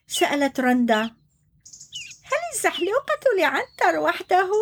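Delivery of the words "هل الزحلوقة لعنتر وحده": giraffe asks. Acted.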